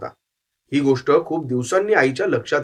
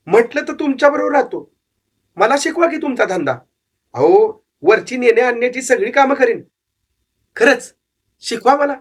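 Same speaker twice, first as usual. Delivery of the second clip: put-on young boy pleading, higher voice, eager; 7 semitones higher than usual. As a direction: beg the old man to take you on. Boy begs to be taught.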